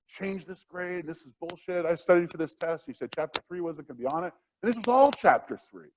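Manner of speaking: high-pitched mock outrage; imitating angry student. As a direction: play the furious student demanding a grade change. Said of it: "they're yelling and they're pissed".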